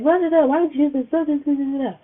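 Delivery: imitating herself yelling at a kid; retold yell. Retelling how she yelled at her little cousin, mock scolding. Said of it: "but I yelled at her".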